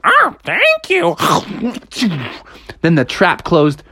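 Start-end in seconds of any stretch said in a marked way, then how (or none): 0.0-1.1 high, slow mouth-stuffed cartoon voice; silly high creature voice; greedy ghost. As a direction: thank them through a mouthful. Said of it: "and he went".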